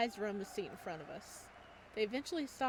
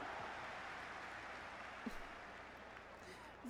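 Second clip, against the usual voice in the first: soft breathy laugh; 11 dB quieter than usual. Acted. Laugh after cheeky line.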